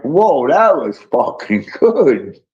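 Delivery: slow, drawn-out amazement; retold awe. Praising Renee's surprise singing, slow and awed. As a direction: savour each word, blown away.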